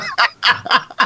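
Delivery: a burst of airy laughter, pitched high; quick amused laugh; high breathy laugh. Real, not acted. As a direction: let a high giggle slip out. Laughing at his own point.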